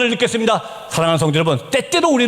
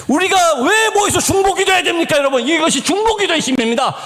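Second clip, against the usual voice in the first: high, ringing rhetorical appeal; forceful preaching question; 8 semitones higher than usual. Real, not acted. Preacher proclaiming intercession's power, high and forceful. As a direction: hammer the question home to the congregation.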